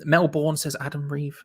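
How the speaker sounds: ordinary delivery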